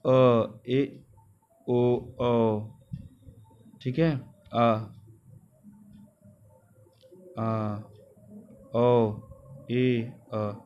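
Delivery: each vowel drawn out, mouth half open; half-open vowel demo. Shown as a demonstration. Teacher demonstrating half-open vowels to students, drawn out. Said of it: "मुख द्वार आधा खुलता है".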